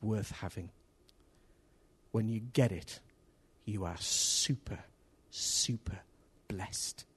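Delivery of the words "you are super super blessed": soft, slow whispered emphasis. Acted. Preacher promising the congregation blessing, whispered. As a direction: breathe it out as a secret.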